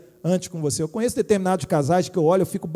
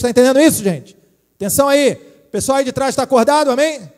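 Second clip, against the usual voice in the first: calling out loud; 3 semitones higher, 9 dB louder than usual. Real, not acted. Waking up the congregation.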